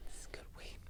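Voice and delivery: whispered, breathy repeat of the line. Real, not acted. Stoned host whispering his praise of the weed. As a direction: whisper it like a secret.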